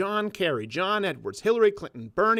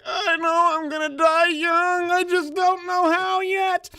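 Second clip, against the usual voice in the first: much higher, louder, slow wailing mock voice; mock doomed Kennedy; 13 semitones higher, 6 dB louder, much slower than usual. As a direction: drag it out like a doomed ghost.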